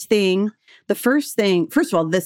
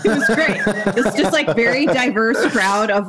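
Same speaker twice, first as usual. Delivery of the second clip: usual speaking voice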